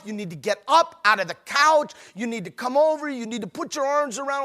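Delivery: ordinary talk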